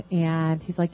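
usual speaking voice

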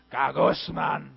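Gagusman in a low, grumbling groan. Shown as a demonstration. Preacher acting out a complainer, low grumble. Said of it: "it sounds like complaining".

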